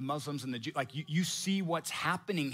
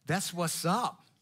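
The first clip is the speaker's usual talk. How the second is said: slow, drawn-out self-satisfied drawl; lightly voiced arrogant Haman; smug Haman; much slower than usual. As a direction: savour the flattery like a show-off. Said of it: "He's like".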